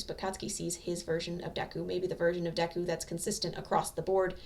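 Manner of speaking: plain reading voice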